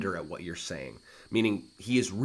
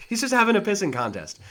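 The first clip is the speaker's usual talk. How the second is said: much higher, laughing remark; amused teacher commentary; laughing aside; 11 semitones higher, 7 dB louder than usual. Real, not acted. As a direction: chuckle at the rivalry.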